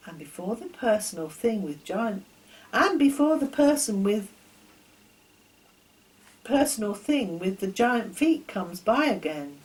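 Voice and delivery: plain reading voice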